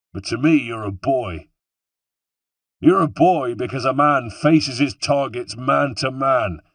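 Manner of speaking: high, mocking West Country villain; scornful West Country tirade, pitch gradually settling; West Country accent. A narrator's reading. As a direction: taunt your opponent in a West Country accent. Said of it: "He had a West Country accent".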